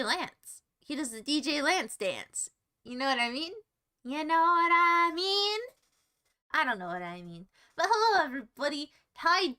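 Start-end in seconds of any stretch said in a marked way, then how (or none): 4.1-5.6 slow, drawn-out, rising question; hammed-up, long-held questioning tone; exaggerated repeat.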